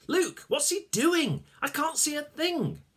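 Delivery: put-on high, fretful old man; grumbling Malcolm. Malcolm stuck in his beard, asking Luke, fretful. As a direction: fuss blindly.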